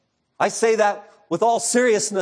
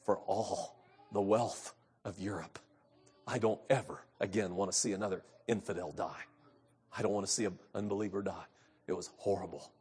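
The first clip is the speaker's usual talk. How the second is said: much softer, lower, hushed horror; 10 semitones lower, 15 dB quieter than usual. Retold or imitated. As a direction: tell it as if still shaken. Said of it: "His nurse said".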